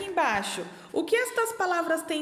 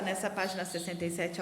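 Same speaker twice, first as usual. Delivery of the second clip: ordinary talk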